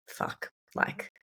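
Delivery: under-the-breath whisper of dismay; whispered curse. Retold or imitated. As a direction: breathe the curse out, almost silent. Knee pain mid-marathon, inner voice.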